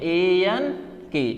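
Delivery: एएनके spelled slowly as separate letters. Shown as a demonstration. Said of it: "तो हम इसको क्या लिखते हैं?". (एएनके को अक्षर-अक्षर धीरे बोलना)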